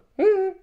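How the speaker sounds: shrill puppet giggle; high squeaky giggle. Acted. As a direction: giggle like a tiny alien. Puppet says bye to fans.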